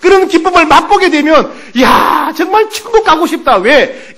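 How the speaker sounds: high, loud, driving sermon delivery; full-force preaching. Real, not acted. Pastor tells the congregation of heaven's joy, preaching at full force.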